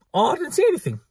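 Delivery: high-pitched mock innocence; exaggerated know-nothing act; feigned innocence. Pretends she would ignore it.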